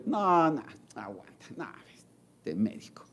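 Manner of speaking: soft, trailing grumble; patient muttering about the doctor; dismissive mutter. Retold or imitated. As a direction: grumble it off under the breath.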